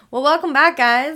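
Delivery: bright loud podcast welcome; host greeting. Acted. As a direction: greet the listeners with energy.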